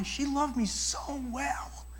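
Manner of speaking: slow, hushed whisper. Retold or imitated. Young man confiding about the girl who saved him, whispered. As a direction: let it fall to a tender whisper.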